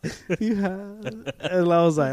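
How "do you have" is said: higher, odd-sounding mock customer voice; imitating the lady. Repeating the customer's question to a friend, mock put-on voice. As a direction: parrot her broken question in a put-on voice. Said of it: "she didn't really speak English that well".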